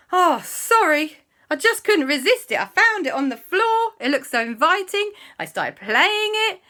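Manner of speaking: high, loud acted excuse; pleading apology. Andy excusing himself to woken friends, high-pitched pleading. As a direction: protest high and sorry.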